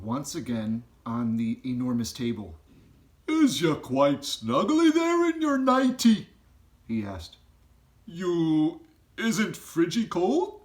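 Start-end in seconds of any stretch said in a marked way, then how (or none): 3.3-6.3 high, loud, odd giant voice with a rough edge of kindness; BFG giant voice. A narrator's reading. Giant asks Sophie if she is snug, high and loud. 8.0-10.6 high, loud, odd giant voice with a rough edge of kindness; BFG giant voice. A narrator's reading. Giant asks Sophie if she is snug, high and loud.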